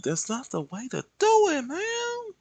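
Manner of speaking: high teasing scold; mock scolding. Real, not acted. Artist mocking his own sloppy line, high. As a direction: tease yourself in a high voice.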